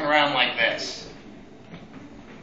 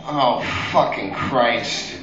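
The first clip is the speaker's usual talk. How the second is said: fed-up groan; 8 semitones lower, 4 dB quieter, much slower than usual. Retold or imitated. Fed-up aside.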